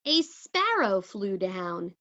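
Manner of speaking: plain reading voice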